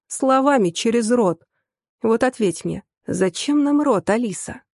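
usual speaking voice

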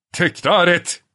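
high, loud thundering command; gürleyerek. A narrator's reading. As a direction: roar the order. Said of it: "diye gürledi Bormental". (gürleyen emir)

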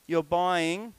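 normal talking voice

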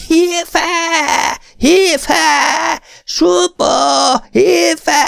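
very high, loud, desperate shrieks; horrified screaming. A narrator's reading. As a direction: scream out the window in terror. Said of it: "végső írtózattal üvölt bele az őszi éjszakába". (a nő iszonyattal üvölt ki az ablakon)

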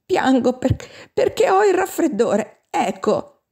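put-on high-pitched, whiny childish pique; petulant, halting little-kid retort; childish spite. A narrator's reading. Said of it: "con un dispetto bambinesco".

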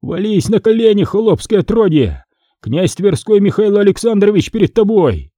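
high-pitched, urgent scolding; furious frightened whisper. Acted. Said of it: "зашептали яростно и испуганно". (яростно и испуганно, но в голос)

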